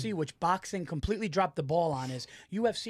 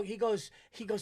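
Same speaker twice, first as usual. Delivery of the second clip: ordinary talk, nothing marked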